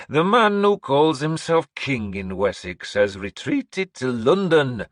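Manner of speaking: slow, high, loud proclamation; old seeress announcing. Acted. Seeress announcing to the hall, high and slow. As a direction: proclaim like an eerie prophetess. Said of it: "Breda declared".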